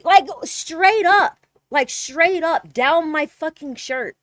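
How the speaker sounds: outraged emphasis. Real, not acted. Telling listeners how blatant the creep was, slow loud outrage. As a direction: stretch every word out in disbelief. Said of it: "emphatic outraged retelling".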